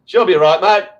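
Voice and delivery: carefree Aussie Ocker slogan; Ocker Aussie accent. Retold or imitated. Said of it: "the Aussie, um, you know, Ocker".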